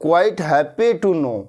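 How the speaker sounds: slow, carefully spaced English; slow teaching read; slow model phrase. Shown as a demonstration. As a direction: spell it out word by word for learners. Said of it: "आपके विचार जान करके मुझे बहुत प्रसन्नता हुई".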